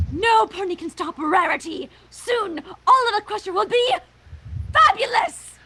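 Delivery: grand villainous boast rising in pitch; villain proclamation. Acted. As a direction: crow it like a pantomime villainess.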